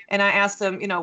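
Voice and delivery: ordinary talk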